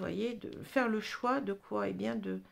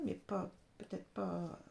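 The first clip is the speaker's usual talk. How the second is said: ordinary delivery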